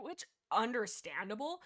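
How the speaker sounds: usual speaking voice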